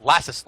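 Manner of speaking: "Lasses" repeated as LASS-iz with a rise; mock pronunciation. Shown as a demonstration. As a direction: echo "Lasses" as LASS-iz, asking. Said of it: "It's pronounced lasses".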